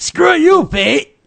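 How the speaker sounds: high, defiant taunt; defiant mock shout. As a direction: yell it up at fate. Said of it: "That's like telling fate".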